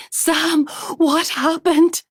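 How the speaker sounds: high, loud, slow near-sobbing cry; a frantic yell at his twisted legs. A narrator's reading. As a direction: yell it on the edge of tears. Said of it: "Now she's yelling".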